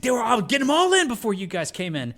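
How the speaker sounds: high, loud yelling voice; impression of the captain. Police captain yelling at the two cops, high and loud. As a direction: yell it like an angry boss. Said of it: "I was like".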